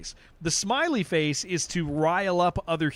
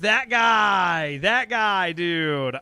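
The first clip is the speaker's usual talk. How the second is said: excited outburst; 5 dB louder, much slower than usual. Real, not acted. Streamer reacting to a newsworthy namesake, loud excited outburst. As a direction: burst out as if you can't believe it. Excited about British fugitive.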